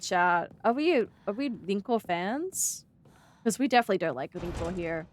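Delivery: usual speaking voice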